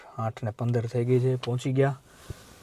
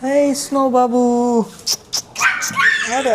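high, slow, stretched cooing; 10 semitones higher, 6 dB louder, much slower than usual. Real, not acted. Owner greeting his dog Snow at the door, cooing baby talk.

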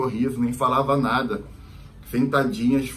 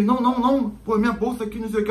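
nervous denial; 10 semitones higher, faster than usual. Retold or imitated. Friend nervously denies.

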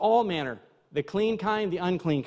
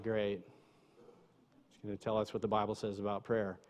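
inward grumble; 11 semitones lower, 14 dB quieter than usual. Retold or imitated. Listener inwardly groaning at a prayer lesson, hushed unenthused grumble. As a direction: grumble it under your breath, much softer and lower. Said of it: "inwardly, not everyone, but, you know".

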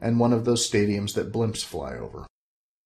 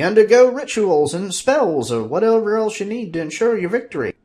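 put-on high, louder old wizard voice; Fezzer's voice; 11 semitones higher, 6 dB louder than usual. Fezzer explaining passes to Bob, high wizard voice.

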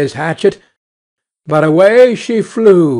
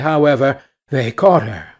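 ordinary delivery, nothing marked

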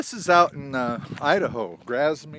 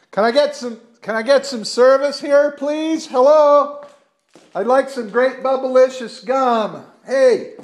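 loud, high call into the empty store; calling down a hallway; 13 semitones higher, 11 dB louder than usual. Real, not acted. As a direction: shout out to find someone in back.

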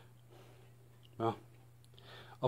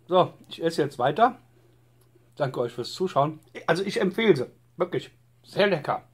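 words muffled by a bite; talking while chewing; 5 semitones higher, 4 dB louder than usual. Real, not acted. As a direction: mouth partly full of food. Seen 2: Calzone held at mouth, bitten at 0.1–0.8, then chewing while talking.

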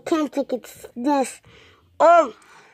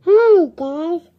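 louder higher bright greeting; cheery host hello; vlogger hello; 4 semitones higher, 7 dB louder than usual. Acted. Vlog intro take.

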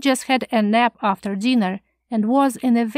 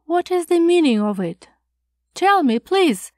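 shocked questioning; 6 semitones higher than usual. A narrator's reading. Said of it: "he asked".

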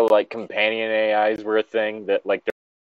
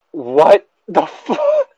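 slow, drawn-out high what the fuck; stunned disbelief; 8 semitones higher, 5 dB louder, much slower than usual. Real, not acted. Reading the story aloud, cut off in stunned disbelief. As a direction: stops reading, thrown by the line.

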